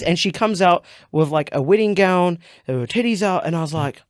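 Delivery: normal talking voice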